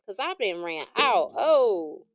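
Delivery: usual speaking voice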